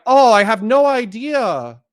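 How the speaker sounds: high, drawn-out uwu whine; mocking cutesy voice. Mimicking opponents who play the victim, mock cutesy voice. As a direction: play the helpless innocent, slow and high-pitched, mockingly. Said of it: "just be like".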